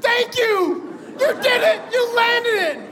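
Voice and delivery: very high, exuberant thanks; elated gratitude. Retold or imitated. Passenger thanking pilot for landing, overjoyed. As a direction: exclaim it way up high, thrilled.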